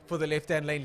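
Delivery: loud, excited race commentary; live play-by-play. Real, not acted. Commentator calling a drag race between two lanes, loud and excited. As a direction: call the finish at full volume.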